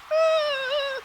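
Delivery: sudden high-pitched yelp; a sharp high startled cry. Acted. Eclipse yelps at landing truck.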